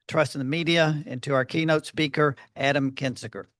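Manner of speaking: ordinary talk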